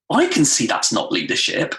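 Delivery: normal talking voice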